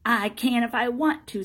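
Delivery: loud, high, stubborn comeback; defiant retort. Acted. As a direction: argue back hotly like a cross child. Boy quarrels with the man.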